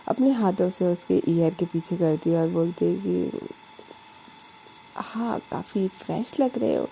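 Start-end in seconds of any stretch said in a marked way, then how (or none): 5.0-6.9 soft flirty. A narrator's reading. Alisha softly complimenting Anu after her bath. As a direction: murmur it up close.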